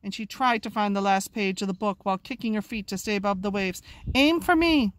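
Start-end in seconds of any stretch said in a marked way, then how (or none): 4.1-4.9 calling out. Acted. Calling up from the sea.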